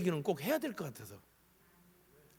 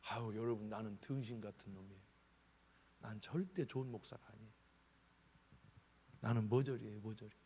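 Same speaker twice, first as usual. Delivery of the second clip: self-reproach; 5 semitones lower, 8 dB quieter than usual. Retold or imitated. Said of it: "그래서 제가".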